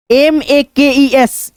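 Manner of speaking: ordinary talk, nothing marked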